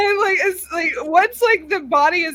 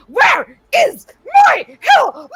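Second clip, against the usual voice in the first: loud, higher, roaring the name Helene; furious demand; 12 semitones higher, 8 dB louder than usual. Acted. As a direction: bellow it at the monster, furious. Grendel roars at the creature.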